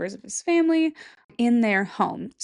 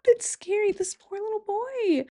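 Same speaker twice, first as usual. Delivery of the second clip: high pitying whimper; 12 semitones higher than usual. Real, not acted. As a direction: voice up high with pity. Pitying the ghost boy.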